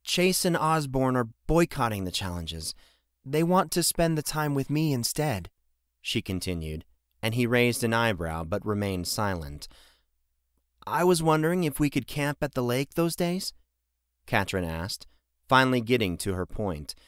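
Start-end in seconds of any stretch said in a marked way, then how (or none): 0.0-5.5 lightly voiced young daughter, a bit higher; a hint of an eager girl pleading; Katrin. A narrator's reading. Daughter coaxing her father, lightly higher. 10.9-13.5 lightly voiced young daughter, a bit higher; a hint of an eager girl pleading; Katrin. A narrator's reading. Daughter coaxing her father, lightly higher.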